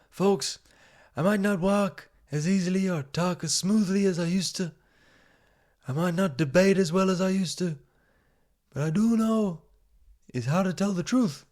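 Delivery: high-pitched put-on American politician voice; rally the folks as an ageing president, pitched high; high, mocking presidential impression; Joe Biden. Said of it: "even worse was Joe Biden coming out".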